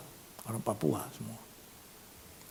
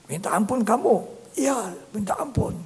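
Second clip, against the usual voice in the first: earnest exchange; 9 semitones higher, 5 dB louder than usual. Retold or imitated. Acted dialogue of repentance.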